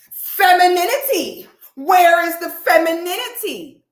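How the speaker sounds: high, loud sneering mimic of the woman; mocking her. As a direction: mimic her prissy taunt to the camera. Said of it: "looks to the camera and goes".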